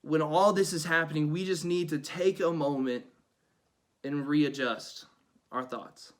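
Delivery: ordinary talk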